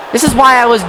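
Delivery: ordinary talk, nothing marked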